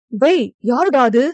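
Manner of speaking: loud, sharp challenge into the dark; angry shout. A narrator's reading. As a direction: bark at an intruder. Man chases intruder in dark. (ஆத்திரமான கூச்சல்)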